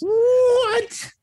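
very high, squealed what; shocked disbelief. Real, not acted. Co-host reacting to a bold three-and-oh pick, shocked disbelief.

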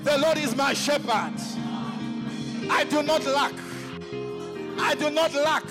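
ordinary talk, nothing marked

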